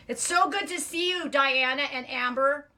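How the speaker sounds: high, drawn-out delighted hello; sing-song, loud on the names; gushing greeting. Real, not acted. Greeting viewers joining the chat.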